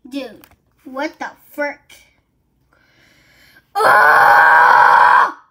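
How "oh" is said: low, loud startled OH; loud low shout. Real, not acted. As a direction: blurt it out in shock. Sudden loud exclamation.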